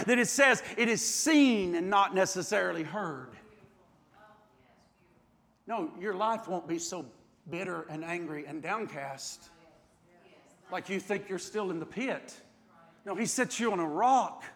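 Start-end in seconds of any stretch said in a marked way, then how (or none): 5.7-14.4 much softer, tender pastoral comfort; hushed reassurance. Real, not acted. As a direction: bring it down close and comfort them. Preacher drops to intimate reassurance.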